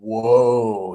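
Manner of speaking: reeling from the smell; hammed-up recoil; mock startled whoa. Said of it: "he was like".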